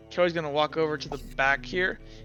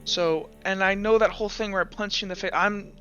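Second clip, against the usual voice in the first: higher, halting character voice; Vex, upset and apologetic; 5 semitones higher than usual. Acted. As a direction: stumble through an excuse and apology. Game master plays Vex.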